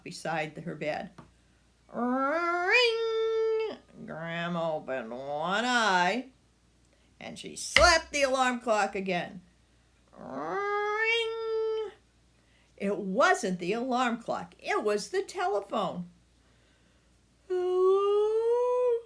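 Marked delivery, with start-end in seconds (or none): 1.9-3.8 high shrill bell ring; ringing alarm. A narrator's reading. Narrator mimicking the alarm clock, high ringing. 10.2-11.9 high shrill bell ring; ringing alarm. A narrator's reading. Narrator mimicking the alarm clock, high ringing. 17.5-19.0 high, sleepy grandma hello; yawning hello. A narrator's reading. Grandma waking up, a yawning high hello. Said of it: "yawned Grandma".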